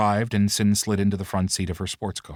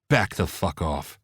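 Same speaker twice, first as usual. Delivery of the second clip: menacing; 2 semitones lower, slower than usual. A narrator's reading. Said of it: "Brandon's words carried menace".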